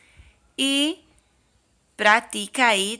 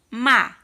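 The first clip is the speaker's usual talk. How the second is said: ma said louder and alone; syllable beat; 5 dB louder than usual. Shown as a demonstration. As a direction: tap out ma clearly. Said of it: "No primeiro quadrinho".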